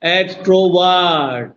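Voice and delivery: Extrovert slowly stretched, "वर्ट" falling away; testing the stress. Shown as a demonstration. Teacher comparing where force falls, extrovert slow and falling. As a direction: draw out extrovert and let its end sink. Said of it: "अब से ज्यादा जोर क्या?".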